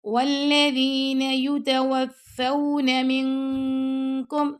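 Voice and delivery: slow chanted recitation; Quran recitation. Shown as a demonstration. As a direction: draw each word out in full recitation. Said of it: "teacher recites Quran verse".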